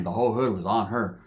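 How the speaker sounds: ordinary talk, nothing marked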